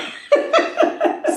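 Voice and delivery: high burst of laughter; real high-pitched giggling laugh. Real, not acted. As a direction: break up at your own joke. Laughs at own bowels joke.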